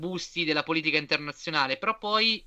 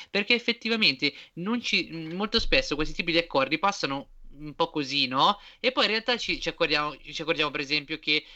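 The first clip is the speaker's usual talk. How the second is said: ordinary delivery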